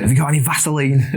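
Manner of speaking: put-on quick, high Yorkshire accent. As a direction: ask it in a broad Yorkshire accent. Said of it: "in a Yorkshire accent".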